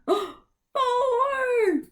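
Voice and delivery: high delighted count; excited count. Real, not acted. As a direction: announce the count with joy. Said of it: "how many syllables".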